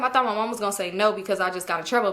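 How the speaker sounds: normal talking voice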